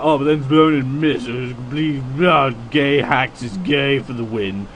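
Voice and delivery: mock complaint in a put-on voice; Nubs. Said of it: "and Nubs is like".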